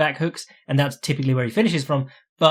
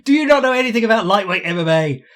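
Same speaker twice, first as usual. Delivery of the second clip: much higher, louder exasperated retort, mock disbelief; exasperated outburst; 8 semitones higher, 5 dB louder than usual. Fan annoyed at pundits saying no one beats Khabib, exasperated outburst. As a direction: snap back at the know-nothings, high and loud. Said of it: "And you're like".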